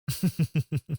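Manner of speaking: high, rippling chuckle; short run of high laughter; amused chuckle. Acted. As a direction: chuckle lightly at the very idea. Amused boss talking to former assistant.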